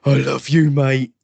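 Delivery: a hint of a loud drunken mate. Brothers teasing a drunk sibling, mock drunk. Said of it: "we will put in the chat going".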